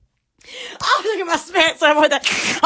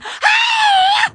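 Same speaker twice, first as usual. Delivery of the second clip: high shrieking burst of laughter; 6 dB louder than usual. Real, not acted. Cracking up at her own joke, shrieking laugh. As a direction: let out a squealing laugh.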